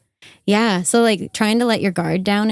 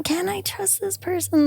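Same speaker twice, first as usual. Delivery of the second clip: high, doubtful self-question; wary inner voice; 11 semitones higher, 3 dB quieter than usual. Retold or imitated. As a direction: ask yourself warily, pitched up. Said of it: "where I was like".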